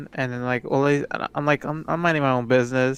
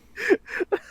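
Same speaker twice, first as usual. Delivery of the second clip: a high, breathy burst of laughter. Real, not acted. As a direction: let a high laugh escape. Laughing at own story.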